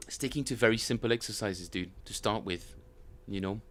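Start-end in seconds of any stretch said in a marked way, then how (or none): none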